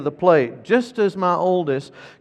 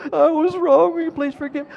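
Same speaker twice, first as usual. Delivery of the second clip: put-on very high little-boy voice; high mock-child echo; parroting child; 13 semitones higher, 5 dB louder than usual. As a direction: squeak the apology back like an obedient kid. Imitates son parroting the apology again.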